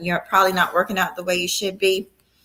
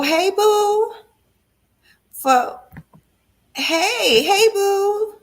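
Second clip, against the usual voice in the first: excited greeting; 13 semitones higher, 5 dB louder, much slower than usual. Real, not acted. Greeting viewers joining live.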